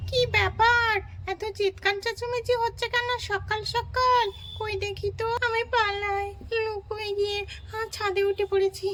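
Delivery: a high small voice asking what's wrong; lightly voiced child.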